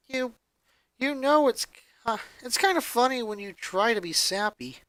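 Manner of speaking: a touch of tearful laughter; broken, half laughing. A narrator's reading. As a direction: crack through the tears, amused. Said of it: "his voice a mix between being broken and general laughter".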